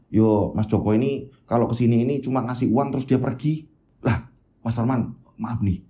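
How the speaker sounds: humble worker shrugging it off; village builder. Acted. Worker Parman answers.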